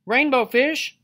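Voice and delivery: high, loud little fish voice; calling out. A narrator's reading. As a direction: call after someone in a high voice. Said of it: "he called".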